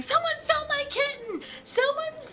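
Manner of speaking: high, shrill-pitched frantic yelling; imitating a hysterical woman yelling; mock screaming woman. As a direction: play the frantic neighbour who lost her kitten. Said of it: "comes in screaming at me saying".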